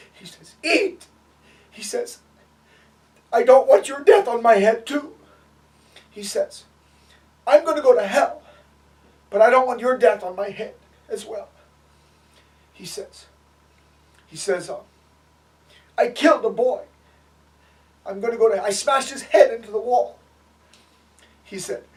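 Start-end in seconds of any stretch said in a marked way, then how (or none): 0.6-1.1 very high, loud cry; retold desperate shout. Retold man shouts at fasting Gandhi. 3.3-5.1 high, loud desperate plea; retold anguished outburst. Retold anguished man pleading with Gandhi. 7.5-11.5 loud, high and distraught; retold anguished outburst. Retold anguished man pleading with Gandhi. 16.0-20.2 loud, raised, distraught voice; retold anguished confession. Retold man confesses killing a boy.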